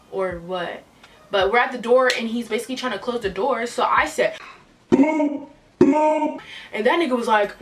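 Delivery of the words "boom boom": two high, punched-out booms; imitated punches. At the door as he closes it, punchy hit noises. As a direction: voice each blow as a sound effect.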